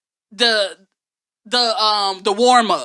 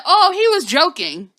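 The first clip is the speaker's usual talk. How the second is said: mocking quote; 7 semitones higher, 5 dB louder than usual. Mocking press secretary quote.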